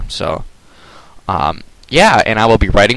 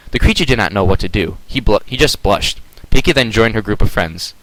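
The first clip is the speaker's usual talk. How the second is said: ordinary delivery, nothing marked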